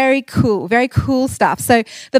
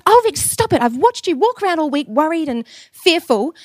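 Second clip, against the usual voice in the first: much higher motherly voice, gently scolding; mum's voice; 6 semitones higher than usual. Retold or imitated. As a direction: play mum telling her child to stop worrying. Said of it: "And she goes".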